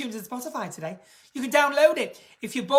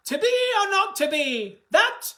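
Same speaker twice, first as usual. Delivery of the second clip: grand theatrical declaim; 9 semitones higher, 5 dB louder than usual. Pretend. Mock Shakespeare declaim.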